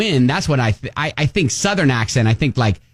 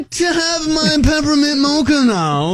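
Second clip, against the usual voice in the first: hammed-up formal coffee order, pitched way up; mock-polite customer; 11 semitones higher than usual. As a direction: stretch the words in a silly high voice.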